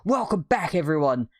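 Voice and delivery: pumped-up challenge video host; Mister Beast. Retold or imitated. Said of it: "He'll be like".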